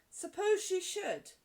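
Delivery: high, loud, drawn-out wonder; slow, thrilled schoolgirl exclamation; excited outburst. A narrator's reading. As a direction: marvel at the possibility. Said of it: "Jessie broke out".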